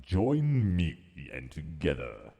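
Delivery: slow, drawn-out put-on voice; grand villainous invitation. Streamer quoting a Star Wars line, grand put-on voice. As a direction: intone it like a theatrical summons. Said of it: "So I was like".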